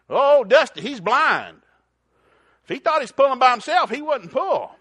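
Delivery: high, loud folksy farmer; farmer's punchline. Retold or imitated. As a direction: deliver the reveal like the old farmer.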